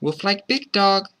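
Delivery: put-on high child coaching a pet; high-pitched, urging child; child's voice. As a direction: instruct in a high little-kid voice. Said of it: "the child told My Dog".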